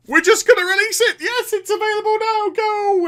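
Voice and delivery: high, loud, fast shouting; mock hype announcement. Presenter announcing a surprise release at BlizzCon, mock-hyped shout. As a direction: yell it like a surprise stage reveal.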